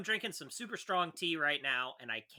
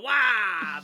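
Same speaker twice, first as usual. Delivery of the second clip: playful shout; 6 semitones higher, 10 dB louder than usual. Pretend. Joking exclamation.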